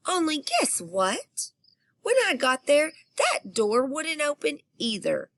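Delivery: put-on high, eager little girl telling a secret; excited child voice. A narrator's reading. Narrator as excited child reaching the bathroom, high and eager. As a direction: pitch it up like a wound-up kid.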